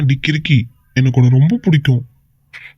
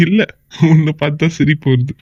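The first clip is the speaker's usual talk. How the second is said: talking through a fond laugh; can't stop chuckling at her. Acted. Husband laughing at his jealous wife, amused laughter. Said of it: "இப்ப என்ன இங்க comedy நினைச்சுன்னு சிரிக்கிறீங்க".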